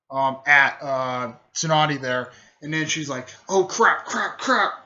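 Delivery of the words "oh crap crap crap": higher, urgent string of curses; mock panic. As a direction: play a fighter suddenly in trouble. Acted alarm during attack.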